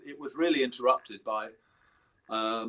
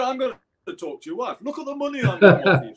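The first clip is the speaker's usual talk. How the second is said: high-pitched impersonation building louder; 10 semitones higher, 9 dB louder, faster than usual. Retold or imitated. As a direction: mimic the insistent bandleader, quick at the end. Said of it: "he said".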